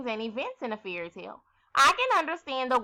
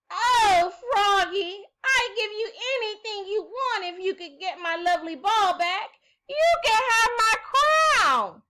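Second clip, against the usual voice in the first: pleading princess; 10 semitones higher, 5 dB louder than usual. Acted. Princess begging the frog.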